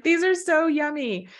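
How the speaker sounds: gushing squeaky voice; high squeaky gush. Retold or imitated. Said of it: "I'm like".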